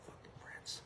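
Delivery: breathless whisper with no voice behind it; whispered aside. Real, not acted. Stressing how absurd his claim is, in a voiceless whisper. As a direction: drop to a hushed whisper in disbelief. Listing halftime performers in disbelief.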